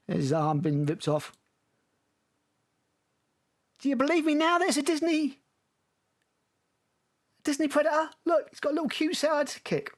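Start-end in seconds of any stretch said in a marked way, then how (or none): 3.8-9.9 high, sarcastic gushing; mock excited. Mocking the trailer's Disney Predator, high sarcastic glee. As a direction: act thrilled to mock it.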